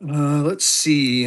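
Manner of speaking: ordinary talk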